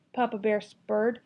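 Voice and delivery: usual speaking voice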